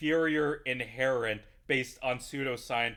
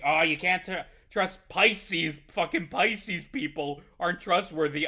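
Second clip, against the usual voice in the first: mock ranting believer; 4 semitones higher, 6 dB louder than usual. Mocking an astrology ranter.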